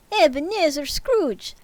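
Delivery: high, slow Russian naming; drawn-out Russian Ebenezer Scrooge; Russian accent. Acted. Ghost calls Scrooge by name in slow Russian accent. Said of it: "How do I say that not in Russian?".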